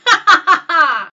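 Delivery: loud evil witch cackle. Acted. Sea witch cackles after the bargain, loud evil cackle. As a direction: cackle with triumph. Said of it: "cackled Ursula".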